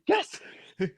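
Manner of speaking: very high, strained celebration; pure relief. Retold or imitated. Player re-enacting his winning throw going in, hugely relieved. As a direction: re-enact an uncool yes of relief, pitch shooting way up. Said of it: "It was not calm and cool".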